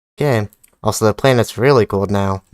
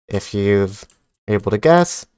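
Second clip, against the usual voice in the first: ordinary talk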